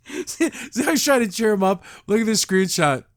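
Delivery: laughing while talking. Real, not acted.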